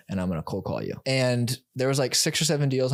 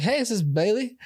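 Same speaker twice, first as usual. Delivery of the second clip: high, bright imitation of an Australian woman's accent; 11 semitones higher than usual. Agent imitating the Australian caller's Australian accent. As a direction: put on an Australian accent as the caller asks for Bailey. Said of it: "She has an Australian accent".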